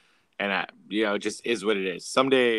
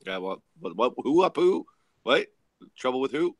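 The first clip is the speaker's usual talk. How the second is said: feigned ignorance; 2 semitones higher, 6 dB louder than usual. Retold or imitated. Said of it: "then you're like".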